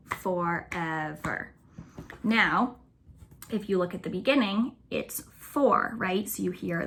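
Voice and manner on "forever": Forever drawn out slowly, syllable by syllable; slow, separated syllables in "Forever"; teacher counting syllables; syllables counted slowly. Shown as a demonstration. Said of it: "another three syllable".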